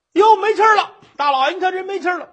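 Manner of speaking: high, loud, rushed cry; 惊慌报告; alarmed report. Acted. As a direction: blurt it out to the magistrate in a panic. Guard alarmed she stopped breathing.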